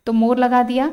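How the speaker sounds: usual speaking voice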